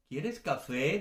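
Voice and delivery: "quieres café" with rising end; question intonation. Shown as a demonstration. Teacher repeats the Spanish question, "quieres café" rising. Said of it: "la entonación de la pregunta".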